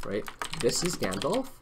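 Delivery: ordinary talk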